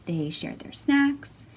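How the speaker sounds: put-on lively read-aloud for small children; storybook reading. A narrator's reading. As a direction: read it like storytime, bright and expressive. Reading picture book to children.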